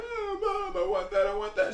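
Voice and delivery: put-on high whimpering child; whiny crying kid. Little boy whining to his mom for a toy, high and teary. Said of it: "He was crying for a toy".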